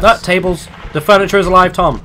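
high, loud cry of alarm; alarmed shout. Real, not acted. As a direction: yell that the furniture lives. Furniture monster appears.

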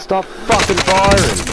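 high, drawn-out protest; exasperated yell. Real, not acted. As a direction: yell it slowly at whoever is shooting. Under fire in a game.

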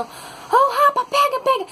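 high-pitched imitated street yell; imitando homem gritando. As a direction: mimic a man raising the alarm. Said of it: "que ele saiu gritando". (imitando grito de alerta, bem agudo)

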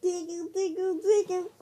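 teasing sing-song tickle; giggly tickle attack. Acted. Tickling again.

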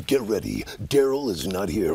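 deep slow trailer announcer; low dramatic announcer voice; trailer voice voicemail. Retold or imitated. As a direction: voicemail greeting as a movie trailer. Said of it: "voicemail message like that".

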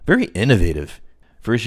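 ordinary talk, nothing marked

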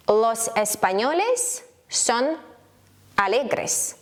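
slow, drawn-out Spanish with pauses; slow teaching delivery. Shown as a demonstration. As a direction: model it word by word for learners. Slow model sentence for learners.

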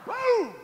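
high whooping shout; excited whoop. Real, not acted. Pastor whooping at the congregation, high shout. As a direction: let out a big woo.

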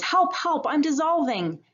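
high, mock panicked cry for help; high-pitched hammed-up plea; polar bear's cry. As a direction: squeal it like a cartoon bear in trouble. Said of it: "the polar bear says".

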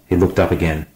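ordinary delivery

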